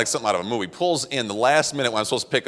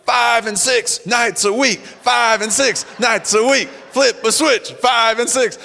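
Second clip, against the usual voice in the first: high, loud, drawn-out chant; imitating an annoying yelled recording; yelling tape; 11 semitones higher, 3 dB louder, much slower than usual. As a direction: bellow it like an obnoxious motivational tape. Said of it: "really, really loud, kind of annoying tape where he's just yelling".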